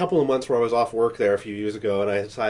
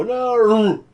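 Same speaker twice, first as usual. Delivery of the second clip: higher, louder anguished no; mock no; 9 semitones higher, 6 dB louder than usual. Acting Vader's recut scene, loud mock no. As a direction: cry out a hammed-up no. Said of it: "the mask stifles the no".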